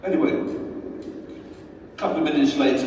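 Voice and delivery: ordinary delivery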